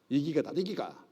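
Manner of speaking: broad Gyeongsang accent, quick and clipped-sounding; Gyeongsang dialect. Shown as a demonstration. Pastor showing the class old dialect, Gyeongsang accent. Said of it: "경상도 본토 발음이에요".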